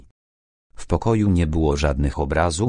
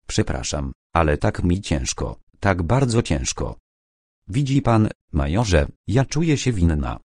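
usual speaking voice

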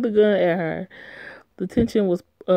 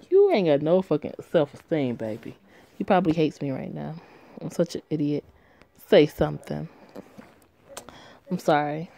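ordinary delivery

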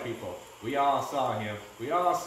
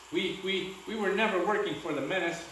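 stammering; 6 semitones higher than usual. Acted. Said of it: "Jillian shuddered".

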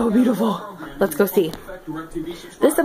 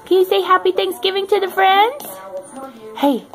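higher pet-talk voice; talking to dog; 4 semitones higher than usual. Real, not acted. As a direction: coo at the dog.

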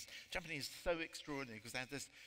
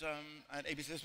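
ordinary talk, nothing marked

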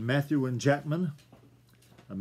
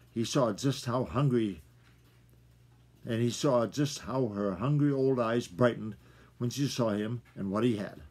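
ordinary delivery, nothing marked

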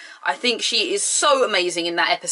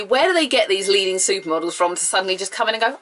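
ordinary talk

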